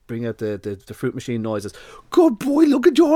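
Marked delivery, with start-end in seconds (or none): 2.1-3.2 much higher, loud gushing praise; excited praise. Retold or imitated. Owner rewarding the dog with fruit machine noises, loud and high. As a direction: go over the top like a jackpot.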